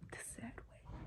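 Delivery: whispered "The sad way", breathy and hushed. Shown as a demonstration. To viewers ending the story, a whispered echo.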